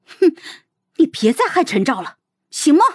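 huff, then plead in bitter indignation; grief and indignation. Acted. Xiao girl confronting the princess at sword point, grieved and indignant. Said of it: "悲愤至极地指着我说".

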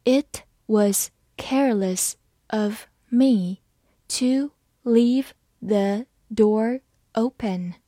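slow, word-by-word reading of the sentence; read slowly. Shown as a demonstration. Teacher reading slowly so students can follow. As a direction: spell each word out slowly for learners. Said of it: "好，慢慢来读".

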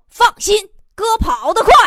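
cocky boast. Acted. Boastful boyfriend reply.

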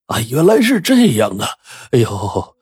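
high, strained character voice; sudden realisation. A narrator's reading. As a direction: draw out the dawning understanding, then groan. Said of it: "马王爷恍然大悟啊".

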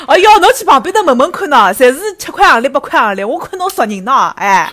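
loud, high auntie laughing while she bargains; market auntie voice. Pretend. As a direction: talk the customer round like a stall auntie. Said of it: "假装阿姨".

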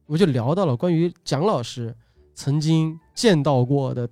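normal talking voice

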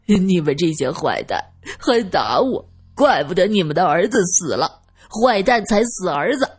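put-on childish tearful whine; crying. Simpleton cursing those who hit him, crying childishly. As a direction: blubber accusingly like a wronged child. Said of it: "二先生哭着说道".

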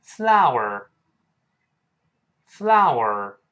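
very slow "flower", every vowel sounded; drawn-out model word. Shown as a demonstration. Teacher models the word for students, very slow "flower". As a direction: stretch "flower" through ah, oh, er. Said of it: "我们读一下花这个词".

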